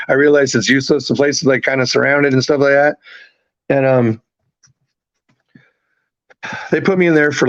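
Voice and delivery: ordinary delivery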